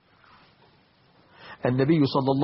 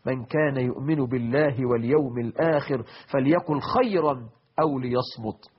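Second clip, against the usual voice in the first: ordinary talk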